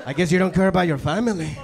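lightly voiced cartel menace; a hint of a drug-lord threat; Narcos threat. Language app threatening a truant learner, mock cartel menace. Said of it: "it becomes Narcos all of a sudden".

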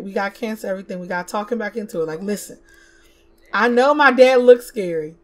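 usual speaking voice

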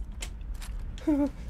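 high-pitched, airy villain giggle; soft manic titter; gleeful giggle. Pretend. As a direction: giggle with mad anticipation. Gleeful before stabbing in game.

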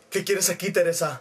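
low-voiced man confronting a woman; Arturo. Arturo faces Teresa at his door, low and curt. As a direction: put on a low, stern man's voice. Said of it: "y Arturo le dijo".

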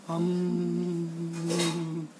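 long, soft, level drone; bee hum. Acted. Honeybee pausing its tale of work, humming. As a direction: hold a quiet bee-like hum.

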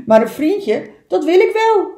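loud, rising to high and keen; eager otter voice. Acted. Otter accepting an offer of friendship, loud excited voice. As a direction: burst out with loud delight.

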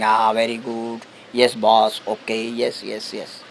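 soft, fading yes boss to the boss; meekly agree, voice sinking away; fake yes-man. Employee answers the angry boss, softly, meekly fading. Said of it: "but outside you showing".

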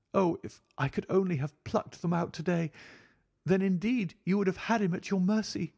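high, impassioned female voice; fiercely vengeful wife. A narrator's reading. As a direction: exult bitterly as a high-voiced woman. Narrator voices Mrs Stapleton.

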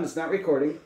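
usual speaking voice

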